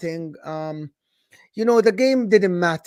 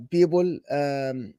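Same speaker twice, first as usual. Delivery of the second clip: ordinary talk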